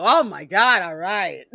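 loud, exasperated give-in; vampire giving in, loud and fed up. Retold or imitated. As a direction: cave in with an exasperated groan. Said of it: "other vampires can't help but go".

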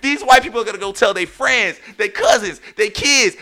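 high, loud, shouted slow mock panic; embarrassed panic. Man caught loving watermelon panics, shouting high and loud. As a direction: wail like you let everyone down. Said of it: "I was so embarrassed because I was like".

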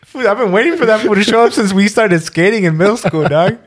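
high, loud, incredulous teasing; laughing mockery. Real, not acted. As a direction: roast your buddy while cracking up. Roasting friend after long laugh.

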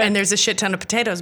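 usual speaking voice

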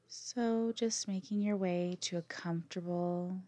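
calm yoga nidra guide, long pauses between phrases; unhurried, drawn-out words with lots of space; guided meditation. Acted. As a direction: lead them gently through the breath.

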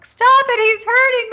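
very high, loud hammed-up cry for help; shrieky theatrical distress; mock screaming. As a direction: imitate a hysterical victim screaming. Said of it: "runs out of his office screaming".

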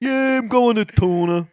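high, slow Jersey guy drawl; New Jersey accent. Retold or imitated. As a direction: answer like a proud Jersey local. Joking New Jersey guys going to Mercury.

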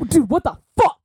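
high, loud waking outburst; startled yell. Retold or imitated. Brendan waking up in a hot room, loud startled yell. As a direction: blurt it out, jolted awake and angry. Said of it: "I just hear Brendan go".

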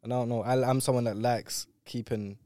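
ordinary delivery

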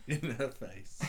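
laughing mockery. Real, not acted. Mocking a clumsy phrase.

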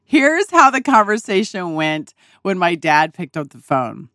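usual speaking voice

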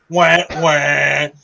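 mocking sad trombone, spoken; sarcastic wah wah. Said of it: "enough sarcasm in my voice".

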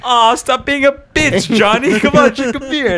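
high, slow, stretched-out taunt; drunk coworker. Retold or imitated. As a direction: drag it out high like a pushy drunk. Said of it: "He's like".

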